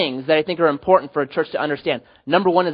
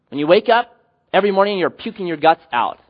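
normal talking voice

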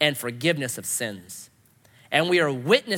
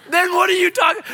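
high, mock-exasperated shout; exasperated outburst; 12 semitones higher, 7 dB louder than usual. Challenging the man at the gas station, exasperated shout. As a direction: burst out in comic disbelief.

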